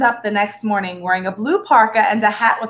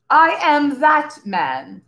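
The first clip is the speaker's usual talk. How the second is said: slow, proud declaration, chest puffed out; slow swelling pride; proudly; 5 semitones higher, much slower than usual. A narrator's reading. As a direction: savour each word like a boast. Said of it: "swelling with pride".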